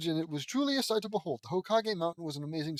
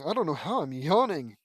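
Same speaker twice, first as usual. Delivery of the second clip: ordinary delivery, nothing marked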